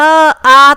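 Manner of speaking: demonstrated long आ; clear vowel demo. Shown as a demonstration. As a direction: sound out आ distinctly. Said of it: "उनका उच्चारण करेंगे".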